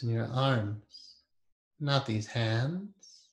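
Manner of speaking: guided meditation. Acted. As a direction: ease them inward with long pauses.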